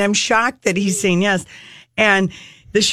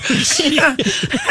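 nervous laugh; 5 semitones higher than usual. Real, not acted. Laughs at the absurd plan.

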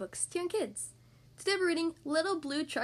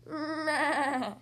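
wavering bleating meh; goat bleat. Pretend. Goat speaks in story.

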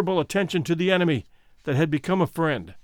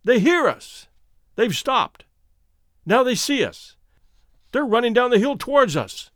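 high, excited exclamations; excited cry; 6 semitones higher, 3 dB louder than usual. A narrator's reading. Trapped man tells his companion rescuers are running towards them, excited cry. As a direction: cry out the good news in bursts. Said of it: "cried Ulrich".

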